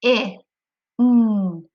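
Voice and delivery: "I" as a short ih, "N" as a held nnn; sounding out. Shown as a demonstration. Teacher tapping out thin for children, sounding out each letter. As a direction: tap out separate sounds, short "I" then humming "N". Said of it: "Let's tap that".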